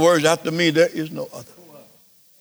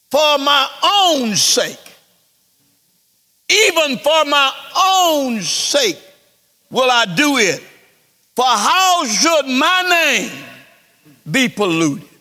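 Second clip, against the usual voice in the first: slow, higher, stretched scripture proclamation; preaching; 7 semitones higher, 4 dB louder, slower than usual. Acted. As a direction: lean hard on "own".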